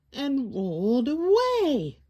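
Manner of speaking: usual speaking voice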